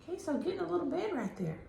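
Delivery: high, fast, soft chatter; pet talk. Real, not acted. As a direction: fuss over the pet in a high voice. Talking about the pet.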